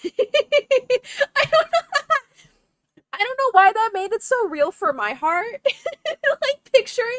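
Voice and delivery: high, loud laughing through happy tears; tearful laughing. Real, not acted. Streamer surprised how moved she is, tearful laughing. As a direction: laugh helplessly while trying to explain. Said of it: "not me crying on main".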